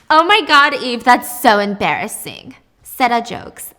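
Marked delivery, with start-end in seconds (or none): 0.1-2.6 mocking laugh. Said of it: "And [name] laughs".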